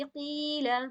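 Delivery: قیلا with a sustained "ee" sound; drawn-out vowel. Shown as a demonstration. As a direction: hold the long "ee" in قیلا. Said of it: "اسے بھی کھینچی جائے گی".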